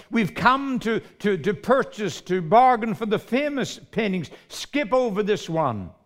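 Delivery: loud, high impatient shout; impatient bidder shouting at the auctioneer. Acted. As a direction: yell it from the back of the room. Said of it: "a voice in the back of the room shouted".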